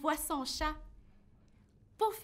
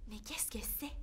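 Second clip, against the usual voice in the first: whisper; 3 semitones lower, 10 dB quieter than usual. Real, not acted. Puzzled at finding the song.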